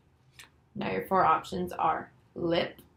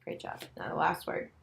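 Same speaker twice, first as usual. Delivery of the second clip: normal talking voice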